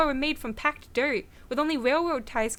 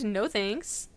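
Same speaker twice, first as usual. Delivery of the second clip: usual speaking voice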